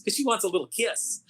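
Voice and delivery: high, coy sing-song of the writer; mock coy writer. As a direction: play the writer coyly pleading for a kiss. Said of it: "And he goes".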